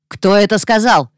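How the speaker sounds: called out loud from a crowd; shouted question. Acted. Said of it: "прокричал один из репортёров".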